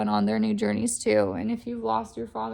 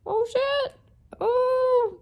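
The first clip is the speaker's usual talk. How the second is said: much higher startled oh shit; a sudden, high, startled exclaim; surprised; 14 semitones higher, 4 dB louder, much slower than usual. Real, not acted. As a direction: caught off guard by a card. Startled by a card.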